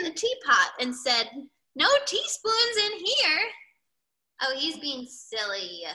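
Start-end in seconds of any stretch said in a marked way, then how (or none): 1.7-3.5 high-pitched storybook call; searching iguana character. A narrator's reading. Reader voices Iguana looking in the teapot, calling out. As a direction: report back from the search, pitched up.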